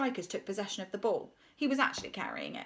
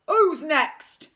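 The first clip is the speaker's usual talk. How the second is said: loud challenge; 5 semitones higher, 9 dB louder than usual. A narrator's reading. Bulk challenging the team.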